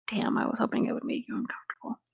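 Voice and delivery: breathy teasing whisper. Real, not acted. Whispering a tease to her friend. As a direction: whisper it like a naughty secret.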